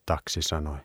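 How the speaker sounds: plain reading voice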